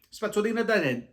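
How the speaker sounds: high, loud eureka Italian; excited blurt. Retold or imitated. Speaker suddenly blurting the Italian word for toothbrush to pharmacist. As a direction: blurt out the word you just remembered.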